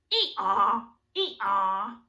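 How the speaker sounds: loud rising hee-haw; donkey bray. Shown as a demonstration. Teacher braying like a donkey for kids.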